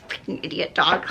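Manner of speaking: low cursing to oneself; cussing in head. Retold or imitated. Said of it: "I'm cussing in my head".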